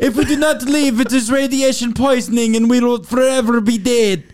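put-on Marie Curie warning her assistant in a thick Polish accent.

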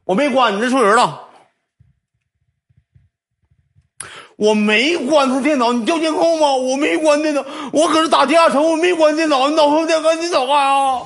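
mock squeaky denial to the attendant; mock high pleading denial. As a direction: whine your innocence in a squeaky voice. Falsetto mocking denial.

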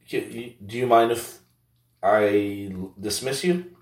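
usual speaking voice

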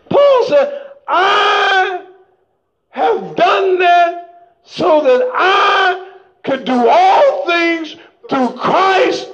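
slow, half-sung preacher's cadence; whooping sermon style; preaching. Acted. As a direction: lift the verse up in song-like preaching. Preaching Paul's words.

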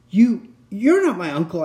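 sudden high, loud blurt. Acted. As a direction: blurt it out in shock. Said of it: "I blurted out".

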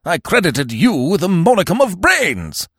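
high, exasperated rebuke; outraged, high-pitched reproach; unreasonable demand. A narrator's reading. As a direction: scold with indignant wrath. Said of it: "he demanded unreasonably".

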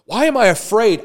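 David shouting down his own fear; David exclaiming. Retold or imitated. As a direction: throw the question out boldly. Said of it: "David's like".

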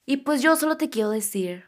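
usual speaking voice

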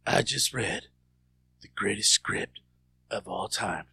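low, slow, halting mock star voice; self-important actor. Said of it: "he goes, he walks out".

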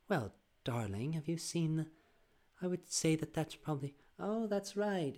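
a gentle British grandmother musing aloud. Retold or imitated. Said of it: "my grandmother's a bit more like".

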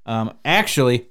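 ordinary delivery

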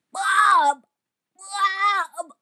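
high, drawn-out squawk of a name; raspy squirrel voice. Retold or imitated. Imitating the lunch aide calling a boy, raspy squirrel voice. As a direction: squawk like an injured little squirrel. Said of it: "her little squirrel raspy voice".

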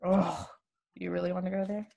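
ordinary delivery

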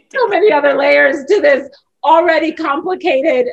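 laughing through words. Real, not acted.

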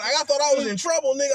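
ordinary delivery

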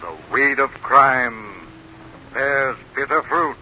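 slow, ominous, menacing delivery; ominous narrator. Acted. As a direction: intone it like a sinister avenger. Signature closing line.

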